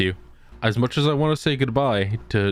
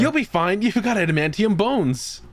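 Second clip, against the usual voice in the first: mock reassurance; 8 semitones higher than usual. Mocking reassurance to grandpa.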